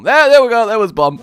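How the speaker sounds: loud, high burst of discovery; brief triumphant outburst; sudden relief. Real, not acted. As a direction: exclaim as you finally find it. Finds forgotten button in game.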